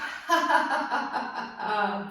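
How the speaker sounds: a full, open belly laugh; big laugh. Real, not acted. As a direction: laugh out loud and let it roll. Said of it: "big laugh".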